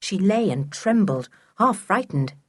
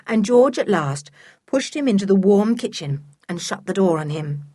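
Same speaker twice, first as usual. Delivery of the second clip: ordinary delivery, nothing marked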